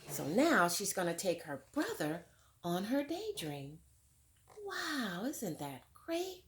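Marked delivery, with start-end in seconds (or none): 4.6-6.4 high, hushed wonder at the story; wow. Real, not acted. Teacher marveling to the children, high and hushed.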